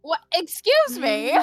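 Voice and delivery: drawn-out scandalised excuse me; mock shocked. Real, not acted. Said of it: "I was thinking the same thing".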